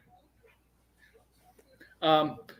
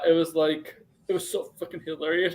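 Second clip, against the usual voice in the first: normal talking voice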